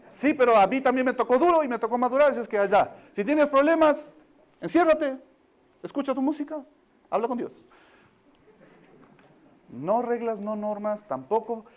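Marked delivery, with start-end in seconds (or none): 0.1-7.7 put-on higher and louder, brushing the kid off; harsh dismissive parent. Parent brushing off his ten-year-old, harsh and louder. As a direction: snap back like a hard-bitten parent.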